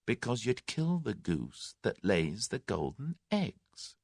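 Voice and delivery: soft, slow and level; calm and quiet, letting the threat sink in. A narrator's reading. Tommy quietly warning the gang, soft and slow. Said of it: "replied Tommy quietly".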